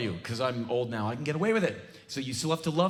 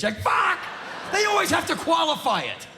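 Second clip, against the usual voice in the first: much higher, loud fed-up rant; exasperated shout; 12 semitones higher, 3 dB louder than usual. Real, not acted. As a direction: blow up at the insult. Ranting comedian exasperated.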